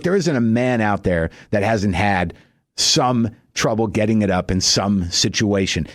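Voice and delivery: ordinary talk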